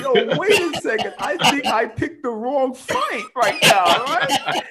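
high, loud, laughing "whoa, wrong fight"; half-laughing alarm at his size; laughing retold panic. Guy telling coworkers how he nearly fought a giant, laughing. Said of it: "I'm like".

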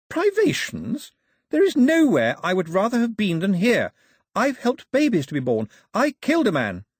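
very high, loud indignant cry; outraged Daphne. A narrator's reading. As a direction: throw the word back at him.

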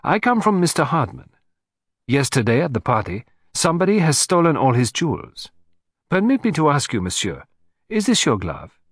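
lightly voiced sleuth, lifted pitch; a hint of a formal foreign investigator; businesslike detective. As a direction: state your errand and question him.

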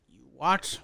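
low villain captain's disbelief; Hook impression. Captain hearing the prisoners were let go, low mock disbelief. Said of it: "And he's like".

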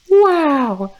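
high, loud put-on wow; over-the-top squeaky awe; mock amazement. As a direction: squeal in hammed-up wonder.

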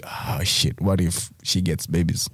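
low, hushed realisation; worried inner thought. Acted. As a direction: drop into a quiet, dreading aside to yourself. Acts out a sudden worried thought.

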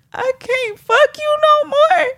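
very high, pleading wail; squeaky heartbroken lament; desperate whine. Shown as a demonstration. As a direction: whine it high like you're about to cry. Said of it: "pick up on the different emotions".

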